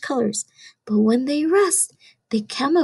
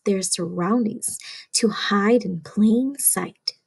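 plain reading voice

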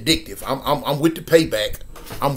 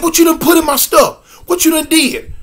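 high, loud furious accusation; imitating a drugged stepfather yelling; accusing shout; 12 semitones higher, 5 dB louder than usual. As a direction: demand what was put in his drink. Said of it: "He said".

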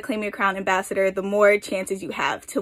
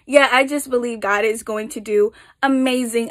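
usual speaking voice

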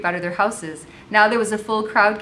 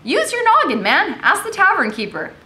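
high, loud exasperated captain; irritated officer, pitched right up; 11 semitones higher, 5 dB louder than usual. A narrator's reading. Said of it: "said with an irritation".

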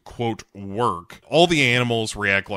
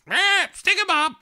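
very high parrot squawk; high-pitched mock bird voice; parrot imitation. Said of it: "And the parrot goes".

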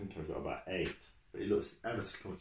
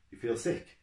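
quick; 6 semitones higher, 4 dB louder than usual. A narrator's reading. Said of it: "the driver asked quickly".

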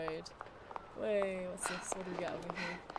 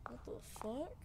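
much softer, breathy whisper; 13 dB quieter than usual. Real, not acted. Streamer lost in dark room, whispered curse. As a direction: hush the curse in disbelief.

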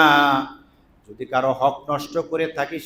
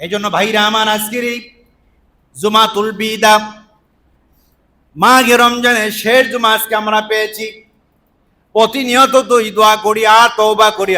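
raised, loud sermon cadence; forceful preaching; 7 semitones higher, 7 dB louder than usual. Real, not acted. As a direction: proclaim it to the congregation.

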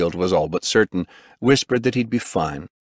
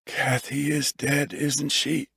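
soft, slow and higher; breathed question; 7 semitones higher, 5 dB quieter, slower than usual. A narrator's reading. Injured husband asking after his wife, breathed softly. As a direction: breathe it out slowly, barely voiced. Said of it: "he breathed".

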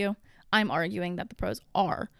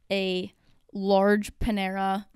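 normal talking voice

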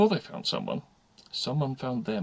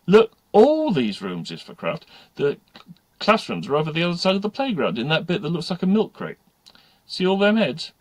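lightly voiced know-all pupil, raised pitch; a hint of cocky schoolgirl, louder; cheeky schoolgirl; 8 semitones higher, 9 dB louder than usual. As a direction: show off the school to a newcomer.

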